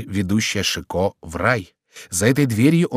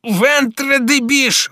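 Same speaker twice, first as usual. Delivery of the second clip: slow, very high-pitched oath; high, explosive swearing; exasperated cry; 4 dB louder, much slower than usual. A narrator's reading. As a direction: cry out the curse, drawing it out. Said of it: "вскричал Шико".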